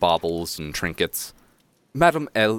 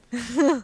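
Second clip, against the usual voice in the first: high giggle. Real, not acted. Player laughs at the scene.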